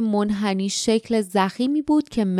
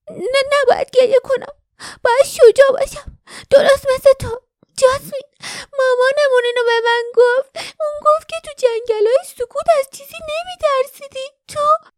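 put-on high, louder, trembling young girl; shaky voice; 6 dB louder than usual. A narrator's reading. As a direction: hold back tears, trembling. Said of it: "لرزانتر از قبل".

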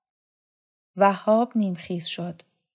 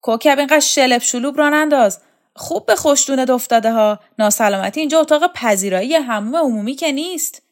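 higher, louder scolding shout; 6 semitones higher, 9 dB louder than usual. A narrator's reading. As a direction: yell across the room at Kokab. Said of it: "فریاد کشید".

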